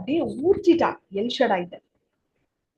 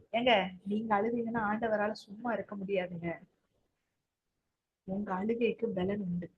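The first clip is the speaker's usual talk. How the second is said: soft reassurance; 2 semitones lower, 9 dB quieter than usual. Real, not acted. Preacher consoles listeners softly.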